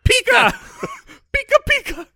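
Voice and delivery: very high cartoon creature shout; Pikachu. Friend mocking how Pikachu would threaten guards, high creature shout. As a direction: squeal it out like a little cartoon mascot. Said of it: "it's just him shouting".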